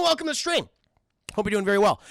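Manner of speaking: usual speaking voice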